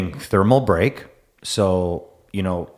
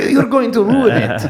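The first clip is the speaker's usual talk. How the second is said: laughing, high and louder; 8 semitones higher, 4 dB louder than usual. Real, not acted. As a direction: laugh through the words. Laughing at teasing.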